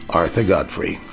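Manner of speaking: usual speaking voice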